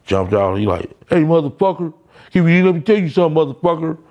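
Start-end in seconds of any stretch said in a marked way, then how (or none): 1.1-4.0 high, fast mock yelling; retold rage, pitched up; angry father. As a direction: voice the furious dad bursting in. Angry father confronts him, retold.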